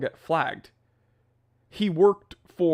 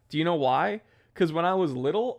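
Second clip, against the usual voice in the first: ordinary delivery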